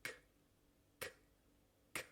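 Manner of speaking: "K" sorda, cerrada atrás, para imitar; k para repetir. Shown as a demonstration. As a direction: dales el modelo de la k. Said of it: "Intenta ese sonido". (k sorda para que el alumno repita)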